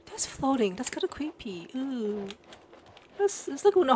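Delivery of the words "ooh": ordinary delivery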